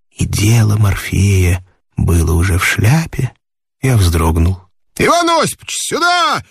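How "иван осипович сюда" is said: high, shouted call from afar; barked call. A narrator's reading. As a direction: bark the name out across the hall. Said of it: "гаркнули где-то".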